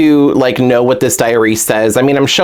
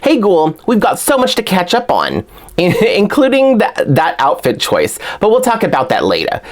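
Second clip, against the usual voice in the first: higher Brooklyn character voice; Claudine; 7 semitones higher than usual. Acted. Doll fan retrying the character's blurb, Brooklyn accent. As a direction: have a playful go at a Brooklyn accent. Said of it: "let me try one more time".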